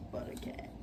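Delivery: unvoiced whisper, to herself. Real, not acted. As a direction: whisper the joke under breath.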